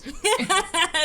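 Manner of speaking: high burst of laughter; loud laugh. Real, not acted. Player laughs at the joke.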